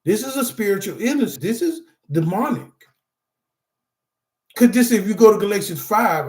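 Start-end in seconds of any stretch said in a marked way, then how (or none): 4.5-6.3 a preacher's rising call to the Bible; preaching. Real, not acted. Speaker to support group, preaching. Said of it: "I don't know why I'm preaching like this".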